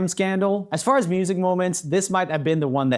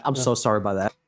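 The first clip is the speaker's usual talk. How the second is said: normal talking voice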